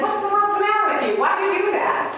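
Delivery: high, fast, rattled what-the; a shaken man blurting out in disbelief; shocked outburst. Retold or imitated. Professor to Marine after the punch, high rattled shock.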